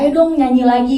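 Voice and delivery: loud, high imitation of eager urging; Bu Dewi's encouragement. Producer urging singer to sing again, imitated eagerly. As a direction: coax keenly, as if cheering someone on. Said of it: "Bu Dewi sih yang semangatin".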